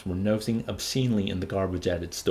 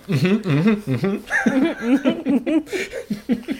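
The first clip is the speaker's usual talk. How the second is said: laughing mm-hmm; 12 semitones higher, 6 dB louder, much slower than usual. Real, not acted. Laughing reply to joke.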